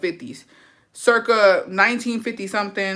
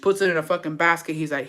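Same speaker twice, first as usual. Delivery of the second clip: ordinary talk, nothing marked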